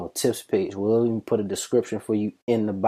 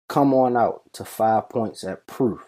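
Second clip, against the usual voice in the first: ordinary talk, nothing marked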